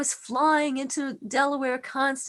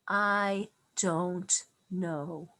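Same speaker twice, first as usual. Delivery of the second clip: gentle admission; 6 semitones lower, 4 dB quieter, much slower than usual. Retold or imitated. Mother comforting dying son gently.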